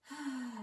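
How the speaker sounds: high audible sigh falling away; exhaling sigh. Shown as a demonstration. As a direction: sigh it out on an open "ha". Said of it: "Exhale, sigh it out".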